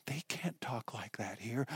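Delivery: hushed whisper of a nervous leader; anxious whisper. Acted. Temple leaders warning Jesus about the crowd, anxious whisper. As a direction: whisper it like someone could overhear.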